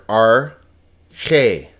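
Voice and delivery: "arche" drawn out slowly in two separate syllables; syllable-by-syllable pronunciation. Shown as a demonstration. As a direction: sound out "arche" slowly as ar, then che. Teacher sounds out Greek word.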